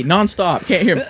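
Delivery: ordinary talk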